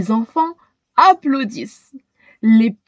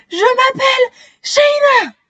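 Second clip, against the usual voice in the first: breathless; 13 semitones higher than usual. A narrator's reading. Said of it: "dit-elle tout essoufflée".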